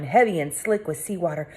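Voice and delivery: ordinary delivery, nothing marked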